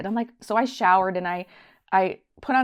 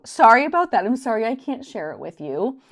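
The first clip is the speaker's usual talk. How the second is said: higher, louder so sorry about that; emphatic apology; 4 semitones higher, 6 dB louder than usual. Real, not acted. To her viewers, an emphatic louder apology. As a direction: apologize big, stressing about.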